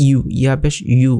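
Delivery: "ইউ" as yu, y plus oo; corrected y glide. Shown as a demonstration. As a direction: repeat "ইউ" with a clear y onset. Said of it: "ইউ হবে".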